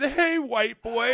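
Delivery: high, loud, slow falsetto yell; mock yelling customer. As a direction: shriek it in a cartoon voice. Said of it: "they said".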